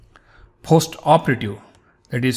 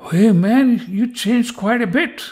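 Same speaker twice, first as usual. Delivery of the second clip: higher-pitched imitated greeting; mock exclamation; 6 semitones higher than usual. People in India noticing he has changed, mock exclamation. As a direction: exclaim it like an imitated old acquaintance.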